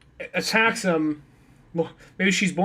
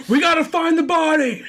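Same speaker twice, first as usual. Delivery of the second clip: high, loud mock-panicked cry; rally accomplices in hammed-up panic; urgent shout; 9 semitones higher, 6 dB louder than usual. Acting panicked hunt for the body.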